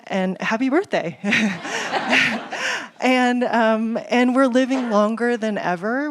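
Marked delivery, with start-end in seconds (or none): 1.2-2.9 laugh. Real, not acted. Laughs at her own joke.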